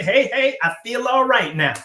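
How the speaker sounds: the squadron's reply in a high, loud Southern twang. Retold or imitated. Troops answering their commander, with loud Southern twang. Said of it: "with a little bit of Southern twang".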